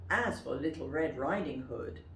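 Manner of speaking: ordinary delivery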